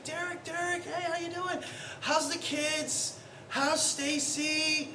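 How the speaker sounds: high, soft, lightly voiced gushing girl; a hint of an eager young woman, slowing at the end; mild high chirpy greeting.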